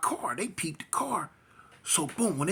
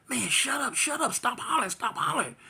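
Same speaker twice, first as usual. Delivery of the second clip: higher, pressing commands; urgent shut up; 9 semitones higher than usual. Retold or imitated. Driver telling screaming girlfriend to shut up, urgent. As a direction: snap at her to stop screaming. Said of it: "I'm steadily telling her".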